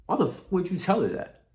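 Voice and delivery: exasperated inner outburst. Retold or imitated. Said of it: "I didn't say this out loud".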